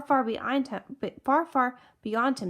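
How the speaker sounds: ordinary delivery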